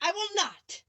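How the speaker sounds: a lightly voiced witch refusing; witch's retort. A narrator's reading. Witch refusing Dorothy, lightly voiced retort. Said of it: "retorted the witch".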